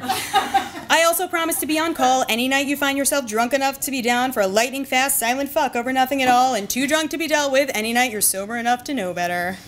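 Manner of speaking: usual speaking voice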